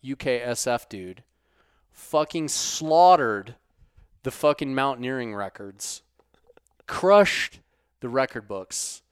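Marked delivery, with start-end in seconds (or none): 1.9-3.6 slow, much louder punched words; emphatic. Real, not acted. Host hyping the climber, slow loud emphasis. 6.9-7.6 much higher, much louder crushed; emphatic. Real, not acted. Host hyping the climber, loud high emphasis.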